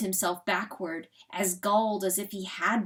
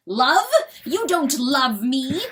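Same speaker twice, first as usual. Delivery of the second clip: loud, raised, incredulous outburst; outraged cry; 5 semitones higher, 9 dB louder than usual. A narrator's reading. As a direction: cry out in angry disbelief. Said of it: "cries Chanticleer".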